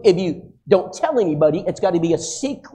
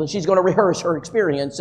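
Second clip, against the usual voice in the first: ordinary talk, nothing marked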